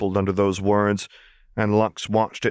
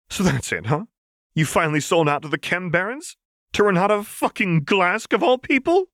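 spat out; 9 semitones higher than usual. A narrator's reading. Vi spits her accusation at Jinx, rising angrily. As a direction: spit the words at her. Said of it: "Vi spat".

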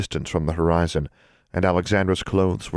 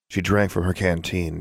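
ordinary delivery, nothing marked

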